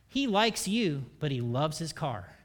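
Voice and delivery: ordinary talk, nothing marked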